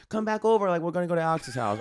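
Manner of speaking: high, quick imitation of a friend; excited neighbor. Neighbor inviting the guys over at night, imitated high voice. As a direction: pitch it up and hurry the invitation. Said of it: "and she'd be like".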